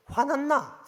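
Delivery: high, loud mock alarm as a Seoul listener; startled question. Seoul visitor hearing Gyeongsang talk, mock startled. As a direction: play the startled outsider asking if he's angry. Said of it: "서울 사람이 딱 내려와서 들으면요".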